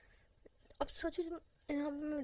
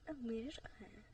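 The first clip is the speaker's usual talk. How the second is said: ordinary delivery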